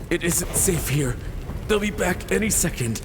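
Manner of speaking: urgent fearful warning. Acted. Wounded mother urging daughter away.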